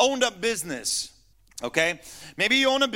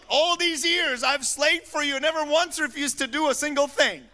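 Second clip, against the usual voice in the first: a hint of a higher, whining older son; mock the complaining son, a touch higher; 7 semitones higher than usual. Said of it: "That's not Junior".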